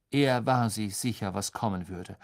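usual speaking voice

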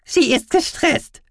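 very high, slow creature voice; loud, squeaky little animal; mouse-beaver voice. Acted. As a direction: translate pointedly in a squeaky voice. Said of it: "übersetzte Guki".

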